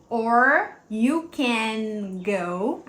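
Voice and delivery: "can" with a neatly closed n, words not linked, very slow; slow careful demo. Shown as a demonstration. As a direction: close the n cleanly. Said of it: "A gente só fecha esse N bonitinho". ("or you can go" bem devagar, N fechadinho)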